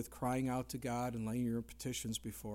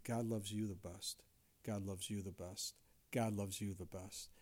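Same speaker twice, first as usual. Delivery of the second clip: tender repeated blessing; 7 semitones lower, 9 dB quieter than usual. Retold or imitated. Pointing at each man.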